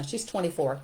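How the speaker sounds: ordinary talk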